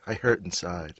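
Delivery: ordinary talk, nothing marked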